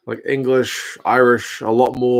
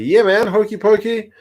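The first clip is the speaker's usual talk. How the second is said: excited; 9 semitones higher than usual. Real, not acted. Chat asks about his flavour.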